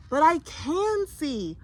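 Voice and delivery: put-on a blind boy insisting to his mother; interrupting boy. A narrator's reading. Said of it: "Herschel interrupted".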